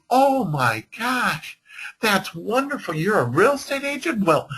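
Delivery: put-on high, delighted older lady gushing; excited lady. A seller gushing to the agents, high and delighted. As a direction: gush like a thrilled homeowner. Lady voiced in retold story.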